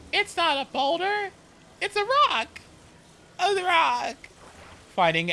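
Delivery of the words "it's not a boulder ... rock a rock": high, mock-earnest gamer voice; mocking boyfriend. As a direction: parody the boyfriend insisting it's a rock. Mocking boyfriend's Minecraft fascination.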